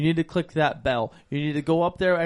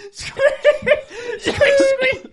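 very high squeaks through laughter; laughing squeak; 8 dB louder, much slower than usual. Pretend. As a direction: squeak like a toy while laughing. Mimicking a squeak.

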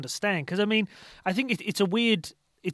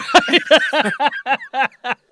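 big hearty laugh; loud laugh; 8 semitones higher, 7 dB louder than usual. Real, not acted. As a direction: burst out laughing. Laughs at own joke.

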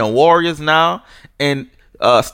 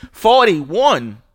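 incredulous; 6 semitones higher than usual. Real, not acted. To Rockets fans brushing off the loss, incredulous. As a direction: repeat the number like you can't believe it.

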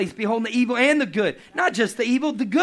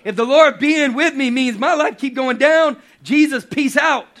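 higher, louder, fast fed-up rant; exasperated, louder and quicker; exasperated outburst; 5 semitones higher, 5 dB louder than usual. Retold or imitated. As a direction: throw up your hands and quit on God. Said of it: "you'd be like".

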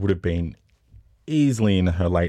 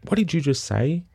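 high, confronting question; mock indignant demand; retold calling out; 9 semitones higher than usual. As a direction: re-enact challenging a heckler without shouting. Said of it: "I called out and I said".

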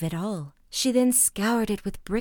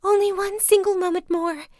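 much higher, louder desperate plea; begging wife; 11 semitones higher, 6 dB louder than usual. A narrator's reading. Wife begging her husband for one more moment, high and loud. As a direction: beg in a high cry.

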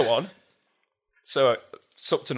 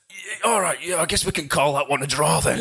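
high, laughing climb-down; imitating the beaten bloke sheepishly; sheepish face-saving; 5 semitones higher than usual. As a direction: play it off like nothing happened. Defeated guy saves face.

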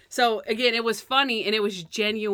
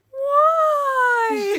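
high wailing mock sob; hammed-up sobbing cry; 8 dB louder than usual. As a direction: wail it like a weeping old lady. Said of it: "I put the sobbing mother".

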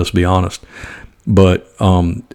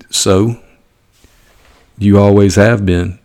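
normal talking voice

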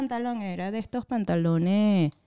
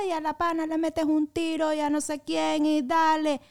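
mild mock yelling; 7 semitones higher than usual. Schoolkids raising the alarm on the bus: high mock yelling. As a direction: imitate schoolkids crying out in alarm, pitched high. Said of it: "empezaron a gritar".

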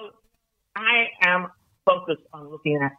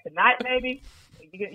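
ordinary talk

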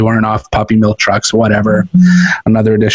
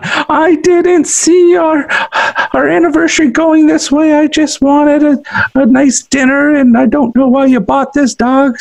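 mock crying wife. Husband mimicking his weeping wife in a high whine. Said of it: "she's crying her eyes out".

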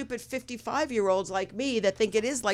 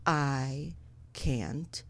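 very slow, low and soft; stoned paralysis; 7 semitones lower, 10 dB quieter, much slower than usual. Retold or imitated. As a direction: drag each word out as if unable to move. Retold being too high to move.

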